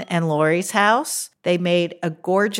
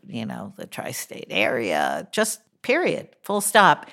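normal talking voice

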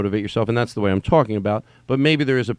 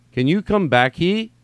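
"he" jumps high like a question; testing the emphasis; 6 semitones higher, 3 dB louder, slower than usual. Acted. Reader retrying the text's emphasis, questioning high on "he". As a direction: lift "he" sharply at the end. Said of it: "putting the emphasis on the wrong part".

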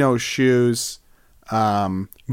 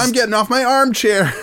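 giddy mock druid; 9 semitones higher than usual. Druid excited about green teeth.

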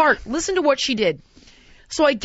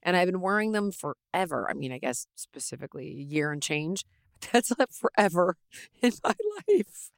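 softer, upbeat sponsor read; 5 semitones lower, 9 dB quieter than usual. Acted. Host reads sponsor ad to listeners, softly. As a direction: sell the underwear warmly.

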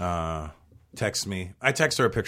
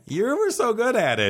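gushing fanboy; 13 semitones higher than usual. Pretend. Mocking gushing fanboy line.

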